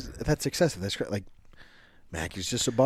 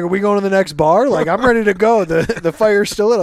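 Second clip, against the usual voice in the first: louder, higher, eager buddy pushing for the next bar; imitating Mackey; 7 semitones higher, 8 dB louder than usual. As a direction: ham up a drunk pal who won't quit. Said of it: "and then looked at me and was like".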